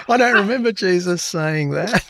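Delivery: high, puzzled priest voice; high-pitched mock doubt. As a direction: object timidly as a sceptical priest, amused. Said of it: "there was no priest there going".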